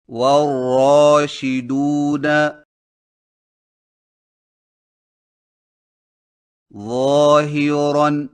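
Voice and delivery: Arabic words with heavy ra, drawn out slowly and loud. Shown as a demonstration. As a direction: recite each ra word slow and thick. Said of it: "मोटी रा की मशक करें". (मोटी रा के साथ धीरे-धीरे तिलावत)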